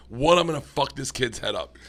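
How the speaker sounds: ordinary talk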